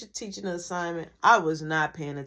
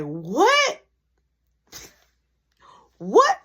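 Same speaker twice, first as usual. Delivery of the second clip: shocked; 7 semitones higher, 9 dB louder than usual. Retold or imitated. Friend's shocked reaction retold.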